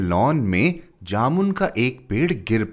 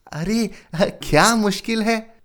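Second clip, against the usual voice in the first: much higher, brushing it off; gardener voice; 13 semitones higher than usual. A narrator's reading. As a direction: scoff at the difficulty. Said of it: "माली बोला".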